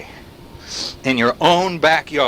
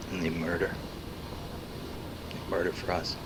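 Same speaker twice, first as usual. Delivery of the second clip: hushed menace; 10 semitones lower, 17 dB quieter than usual. Acted. Dark dramatic accusation.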